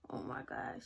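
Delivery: breathy unvoiced aside; whispered oh my God. Real, not acted. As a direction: whisper it to yourself, half in disbelief. Breathless aside while recalling fear.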